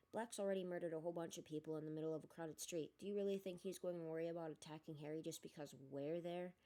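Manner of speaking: usual speaking voice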